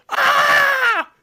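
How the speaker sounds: sudden high shrieking scream; Kevin McAllister scream. Retold or imitated. Kid realizes stranger, screams in shock. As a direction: shriek in panic like a kid left home alone. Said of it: "picture Kevin McAllister".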